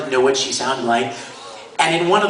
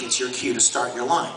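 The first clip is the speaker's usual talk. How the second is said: normal talking voice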